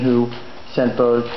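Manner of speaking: ordinary talk, nothing marked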